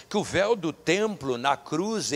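normal talking voice

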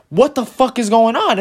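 high, incredulous outburst; exasperated reaction. Retold or imitated. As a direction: demand an answer, baffled and worked up. And I'm like.

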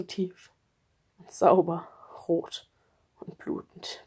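a barely audible whisper. Acted. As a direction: let it fade to a whisper. Describing wounds. (kaum hörbar geflüstert)

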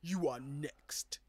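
villain voice. Retold or imitated. Quoting movie villain.

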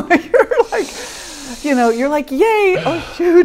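a laughing yay that sinks into dread; laughing half-cry. Retold or imitated. Joy and dread at once.

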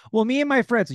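hammed-up mimic; mock woman's voice. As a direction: parrot her words mockingly.

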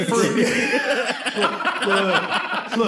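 giggling through a pitch; laughing sales pitch. Acted. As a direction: sell it while cracking up.

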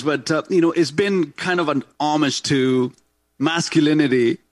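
normal talking voice

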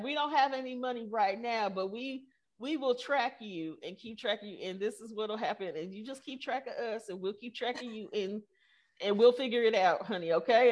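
a gentle hint of a Texas accent, promising to keep track. Texan Googler promising the founder support, in a light Texas accent. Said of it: "She got a real heavy Texas accent".

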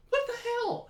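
very high-pitched mock bewilderment; incredulous outburst. Viewer waiting for her revival at funeral, incredulous outburst. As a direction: squeal it in acted disbelief. Acts out own baffled reaction.

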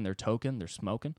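usual speaking voice

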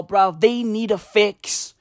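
normal talking voice

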